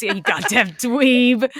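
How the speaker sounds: slow, laughing mock-dominant sneer; mock dom taunt. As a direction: lean into the bossy dom act while cracking up. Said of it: "leaning into this".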